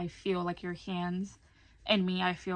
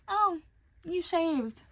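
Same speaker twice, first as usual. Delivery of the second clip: much higher, mock-surprised mom; 10 semitones higher, 3 dB louder, much slower than usual. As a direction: imitate mom's high-pitched "oh" of discovery. Mimics mom's surprised look.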